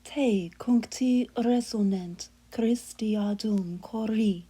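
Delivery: slow, measured church Latin with "cori" falling away; prayerful Latin chant, unhurried; Latin hymn recitation. Acted. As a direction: intone "Christiadum cori" as a liturgical verse.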